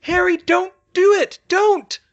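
very high, loud screaming plea; frantic high shout into darkness; desperate scream. A narrator's reading. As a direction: scream at him to stop. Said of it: "she screamed at the darkness".

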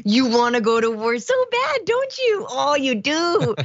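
put-on high cooing voice, teasing like to a child; sing-song mock tenderness; cooing mock baby talk. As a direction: coo at her like a doting parent. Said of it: "it's just kind of adorable 'cause it's like".

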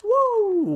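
high, long "woo"; excited whoop. Real, not acted. Player cheering a win in the game, excited whoop. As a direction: whoop in celebration. Celebrates as the match ends.

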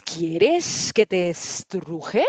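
very slow, sibilant serpent voice; hissing snake. Acted. Snake offering the little leopard a squeeze, hissing slowly. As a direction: stretch every s into a loud hiss. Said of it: "si sea la serpiente".

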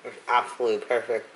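speaking between bites of taco; talking while chewing. Real, not acted. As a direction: mouth partly full of food. Seen 3: Took bite at -4, jaw still working, holding taco while talking.

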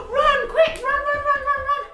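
loud, high panicked urging; urgent cry. Acted. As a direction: cry out as you flee the wolf. Fleeing from the wolf.

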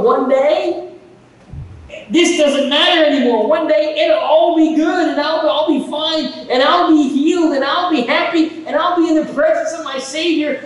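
joyful hope. Retold or imitated. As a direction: lift each promise up with joy. Dying woman's hopeful vision.

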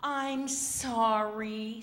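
lightly acted regret; sighed apology. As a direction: draw out a regretful sorry. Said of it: "sighed Missus Penguin".

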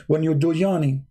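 ordinary talk, nothing marked

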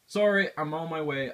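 Sorry, I'm on my way called out loud; loud hurried call. Real, not acted. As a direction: shout it while rushing off. Calling to someone urgently.

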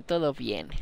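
hushed whispered todo bien. Real, not acted. Repeats phrase, then whispers it.

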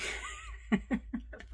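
airy giggle at herself; breathy giggle. Real, not acted. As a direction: giggle softly under the breath. Laughs at herself.

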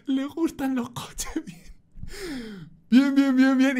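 high, gleeful chant of bien; delighted inner voice. Retold or imitated. Driver thinking with glee that the policeman likes cars. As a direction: squeal with private glee.

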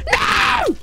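very high, loud cry of horror; horrified shout. Real, not acted. Streamer watching her pet leap into danger, horrified. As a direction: scream it in dismay.

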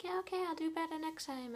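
high, placating acted reply; mock-agreeable, giving in to a scolding; compliant act. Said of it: "you just kinda have to take it".

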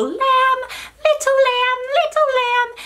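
loud, squeaky high pitch. Shown as a demonstration. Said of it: "with a very high".

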